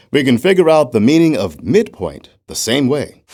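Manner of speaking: ordinary delivery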